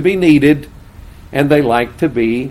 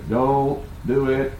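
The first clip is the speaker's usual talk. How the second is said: voicing friend's warning; 7 dB quieter, slower than usual. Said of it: "I heard him say".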